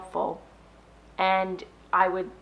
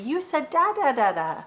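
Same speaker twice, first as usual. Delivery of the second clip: put-on high excited praise to a baby; a delighted dad cheering on his baby; dad praising his baby's first da, excited; 7 semitones higher than usual. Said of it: "the dad is going to get excited".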